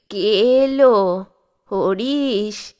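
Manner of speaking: put-on very slow, drawn-out old man's call; an ancient man straining to hear his son; frail old grandfather. A narrator's reading. Very old grandfather calling son Harish, slow and frail.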